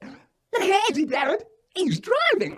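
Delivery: put-on a kid alarmed, telling dad; child's voice. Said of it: "And Joe says".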